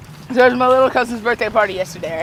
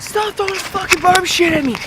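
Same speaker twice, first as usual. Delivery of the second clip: higher, strained retold complaint; exasperated telling-off; 7 semitones higher, 4 dB quieter than usual. Teen tells cousin to stop throwing toys, exasperated and higher. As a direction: pitch it up in mock exasperation.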